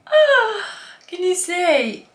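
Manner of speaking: high-pitched gasping disbelief; shocked. Real, not acted. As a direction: exclaim high in shock, drawing out the words. Shocked by too much shimmer.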